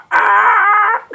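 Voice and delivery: very high, loud mock squawk; a hammed-up shrieking cry; chicken squawk. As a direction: screech like a crazed chicken. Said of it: "and go".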